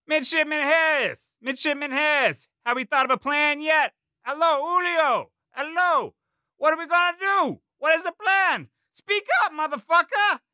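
high, loud drill sergeant bark; drill sergeant yelling. A narrator's reading. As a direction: bark it like a gunny. Drill sergeant berating cadet.

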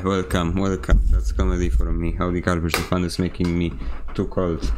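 ordinary talk

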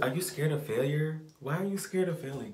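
usual speaking voice

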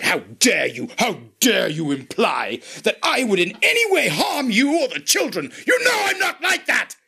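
high-pitched outraged bellow, reenacting an argument; furious shout. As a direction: roar indignantly, climbing to a screeching peak. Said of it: "your shout is a loud".

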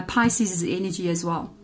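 ordinary talk, nothing marked

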